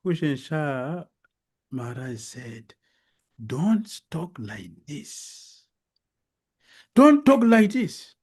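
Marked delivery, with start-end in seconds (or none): 3.4-5.6 slow, softer warning; measured and drawn out, held back; restrained rebuke. Acted. King warning the devotee, slow and restrained. 6.9-8.1 loud, high, slow reprimand; forceful rebuke. Acted. King rebuking the devotee, loud and forceful.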